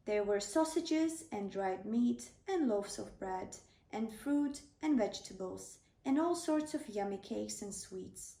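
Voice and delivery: ordinary delivery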